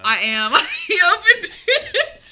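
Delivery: high squealing laugh. Real, not acted. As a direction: laugh through the words. Caught eyeballing candy.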